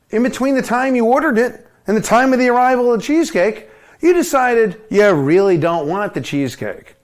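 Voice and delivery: ordinary talk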